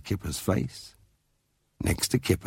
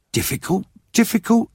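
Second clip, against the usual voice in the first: incredulous, rising higher and louder; exclaiming; 14 semitones higher, 5 dB louder than usual. A narrator's reading. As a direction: repeat it in disbelief, climbing. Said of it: "exclaimed Mum".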